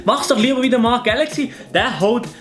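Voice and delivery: ordinary talk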